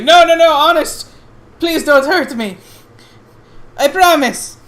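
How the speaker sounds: high, pleading goblin in a hammed-up Italian accent; frightened gamblin in a horrid Italian accent; bad Italian accent. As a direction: beg for mercy in a terrible fake Italian accent. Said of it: "the goblin Italian".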